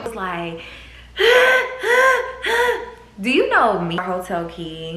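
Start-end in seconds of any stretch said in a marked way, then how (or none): 0.7-2.9 high, loud laughing gasp; shocked gasp. Retold or imitated. Hearing the room number, a high laughing gasp. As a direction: gasp in mock horror.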